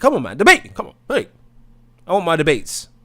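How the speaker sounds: loud, high-pitched goading; rowdy challenge to the crowd; goading call-out. Real, not acted. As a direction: egg them on with sudden high shouts. Calling viewers to debate.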